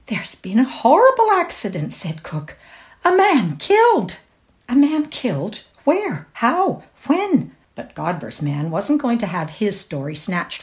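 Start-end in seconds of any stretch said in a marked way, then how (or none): 0.1-1.9 shocked announcement. A narrator's reading. Cook breaking shocking news. 3.1-4.2 high, loud shocked statement; agitated cry. A narrator's reading. Cook blurting the death to Laura, high and loud. 4.7-7.5 high, urgent shocked questions; alarmed questions. A narrator's reading. Laura questioning the cook in shocked alarm.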